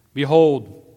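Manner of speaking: proclaiming. A narrator's reading. Pilate presenting the king to the Judeans, loud proclamation. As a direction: call it out to the crowd.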